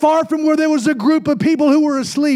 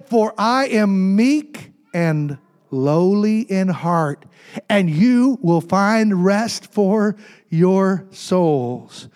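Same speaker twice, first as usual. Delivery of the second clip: slow, deliberate and lower, spacing out the words; slow scripture quote; 5 semitones lower, much slower than usual. Retold or imitated. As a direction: quote it solemnly, stretching each phrase. Slow solemn quoting of Jesus.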